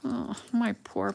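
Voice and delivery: ordinary talk, nothing marked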